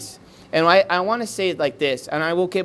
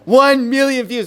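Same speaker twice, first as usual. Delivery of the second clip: higher and louder, a laughing proclamation; driver's excited announcement; 10 semitones higher, 7 dB louder than usual. Retold or imitated. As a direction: blurt out the number, half laughing. Silent driver suddenly announces number.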